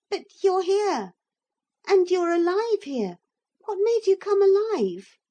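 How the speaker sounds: put-on high, loud amazed child; a boy baffled by a living toy; high excited boyish voice; bewildered boy. A narrator's reading. Boy Philip's voice.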